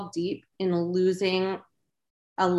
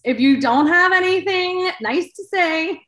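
laughing quip; 11 semitones higher, 6 dB louder than usual. Real, not acted.

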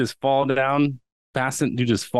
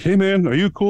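concerned stranger; 9 semitones higher, 4 dB louder than usual. Retold or imitated. Bystanders reaching over barrier.

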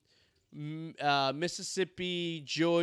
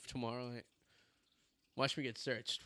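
ordinary talk, nothing marked

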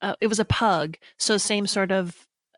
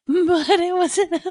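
laughing; 8 semitones higher, much slower than usual. Real, not acted. Laughing at memory.

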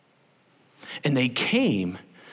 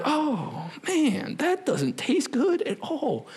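mock disgust, higher; 13 semitones higher, slower than usual. Someone tasting bad milk, disgusted. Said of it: "And you're like".

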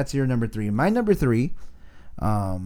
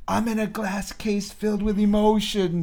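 a hint of Ron Burgundy's overwrought despair, pitched higher and slower; Ron Burgundy quote; 9 semitones higher than usual. Podcast host quoting his favorite Anchorman line, mock-dramatic. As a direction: quote it like a man drowning in feelings.

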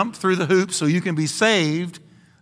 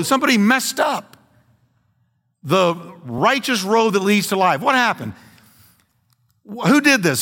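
preaching at full force; 6 semitones higher than usual. Acted. Pastor rousing the congregation against false teachers, fiery preaching. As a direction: build the alarm, somebody broke the road.

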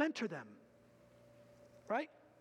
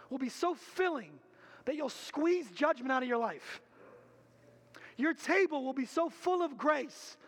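louder, higher, driving sermon voice; full-force preaching; 4 semitones higher, 5 dB louder than usual. Acted. Pastor urges the congregation to confess, preaching hard. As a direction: preach it with fire to the room.

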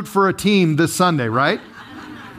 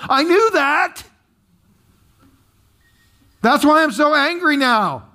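angry complaining Jonah; 7 semitones higher than usual. Acted. Preacher voicing Jonah complaining angrily to the Lord. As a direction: whine it out like an angry prophet. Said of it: "it would sound something like this".

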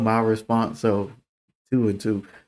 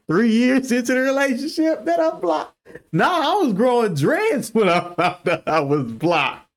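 1 incredulous high; 10 semitones higher than usual. Real, not acted. Incredulous about being blocked.

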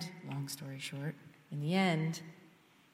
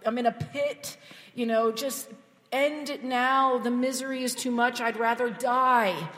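higher, louder anguished lament; David crying out in misery; despairing psalmist; 5 semitones higher, 4 dB louder than usual. Retold or imitated. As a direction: voice David in the pit. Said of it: "so honest about the mess".

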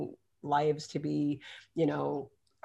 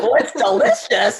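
loud, raised, laughing praise; mock polite enthusiasm; 4 semitones higher, 11 dB louder than usual. Nervous guests praising their hosts' food, mock loud enthusiasm. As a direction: gush loudly through a laugh. Acted reassurance to hosts while eating.

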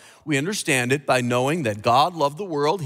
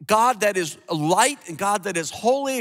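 usual speaking voice

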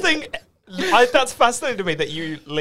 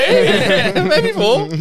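high laughter; laughing through words; 5 dB louder than usual. Real, not acted. Laughing during role play.

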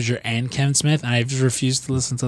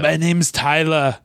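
slow, higher mock Austrian accent; Arnold-style Austrian accent; 5 semitones higher, slower than usual. Mocking Arnold's movie names, Austrian accent. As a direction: put on a thick Austrian accent for the name. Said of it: "funny accent".

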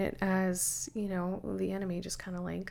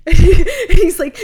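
laughing; 9 semitones higher, 23 dB louder than usual. Real, not acted. Laughing while retelling.

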